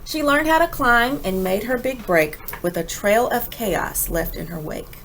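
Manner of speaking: usual speaking voice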